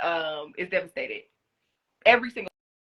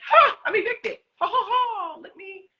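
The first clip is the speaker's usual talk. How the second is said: high, laughing fake delight; mocking a cheerful evicted player; mock gleeful laugh; 5 dB louder than usual. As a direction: cackle like you're happy to be out. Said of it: "anybody's gonna be like".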